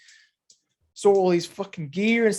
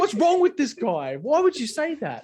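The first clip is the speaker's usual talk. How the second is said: mock outraged Warnie; 10 semitones higher than usual. Acting out Warnie's outraged commentary.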